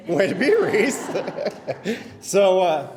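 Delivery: laughing through the words, a bit high and loud; proud giggle breaking into the cheer. Real, not acted. Proud parent at the ceremony cheering daughter, laughing.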